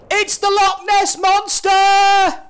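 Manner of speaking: high, slow, loud mock-terrified shout; hammed-up scream. A narrator's reading. Disciple spots a figure on the lake, mock-terrified shout. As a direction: yell it like a spooked fisherman. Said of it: "He screamed".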